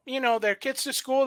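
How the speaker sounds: ordinary talk, nothing marked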